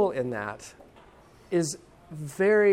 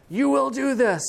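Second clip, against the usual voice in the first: high, loud bossy command; mock stern yell; 9 semitones higher, 5 dB louder than usual. Parent yelling at child, mock stern yell. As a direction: bark the order like a parent losing it. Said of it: "You don't have to yell at your child".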